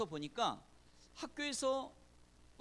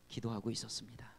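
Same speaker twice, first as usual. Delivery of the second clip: hushed whisper; 8 semitones lower than usual. Acted. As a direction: drop to a breathy whisper on the reveal. Climax of prayer story, hushed.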